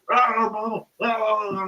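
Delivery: mock rant at nobody in the street; ranting lunatic. Storyteller mimics a man talking to himself in the street, ranting.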